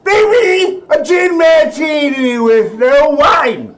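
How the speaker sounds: very high, slow, loud grandma demand, laughing; shouting grandma. Retold or imitated. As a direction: bellow the order like a tipsy grand dame. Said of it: "That's my grandmother".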